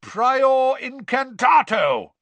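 slow, high, roared incantation. A narrator's reading. Wizard casting a spell on a wand, roaring. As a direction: roar the spell, drawn out. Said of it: "roared Mister Diggory".

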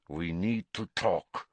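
slow, curt and low, cutting in; abrupt. A narrator's reading. Karkaroff cornering Snape at his desk, curt and abrupt. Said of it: "said Karkaroff abruptly".

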